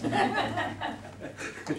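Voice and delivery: a high, breathy burst of laughter at his own slip. Acted. Campaign manager laughing off his own slip, high laugh.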